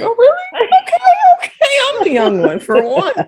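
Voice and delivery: high delighted squeal; excited. Retold or imitated. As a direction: celebrate being young. Retold joy at being youngest.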